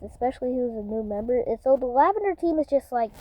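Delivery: ordinary delivery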